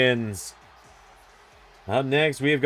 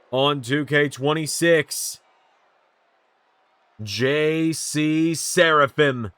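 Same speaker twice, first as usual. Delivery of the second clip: ring announcer. Acted. Introduces wrestler entrance.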